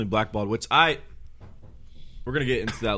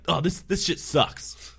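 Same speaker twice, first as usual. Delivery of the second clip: 1 disgusted customer; 8 semitones higher, much slower than usual. Retold or imitated. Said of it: "and he's like".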